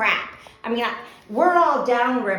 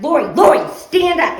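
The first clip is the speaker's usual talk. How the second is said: loud, higher shout to stand up; 7 semitones higher, 13 dB louder than usual. Retold or imitated. Woman yelling at panicking friend to stand, loud shout. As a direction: yell the order across the water. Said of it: "I'm like".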